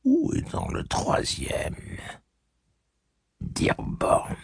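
murmure lent sans voix; chuchoté. Acted. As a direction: souffle la question. Pensée de Jonas. (Jonas se demande tout bas, en chuchotant)

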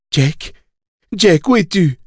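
high searching call. A narrator's reading. Susanna searches for Jack, calling out. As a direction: call high for someone missing.

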